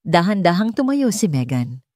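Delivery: plain reading voice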